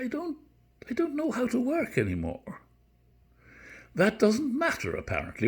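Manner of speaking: high-pitched, lilting Irish reading with swooping pitch; Irish accent. Acted. Teacher reading Beckett to his class in an Irish accent. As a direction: read it high in an Irish accent, with long pauses. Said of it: "Irish accent".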